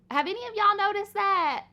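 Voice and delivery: excited question. Real, not acted. Calls out to viewers.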